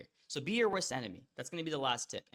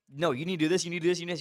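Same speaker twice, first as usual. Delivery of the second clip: put-on louder, higher, pushing hard at his girlfriend; replaying his heated rant with real force; heated insistence; 3 semitones higher, 7 dB louder, much faster than usual. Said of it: "I got a little heated".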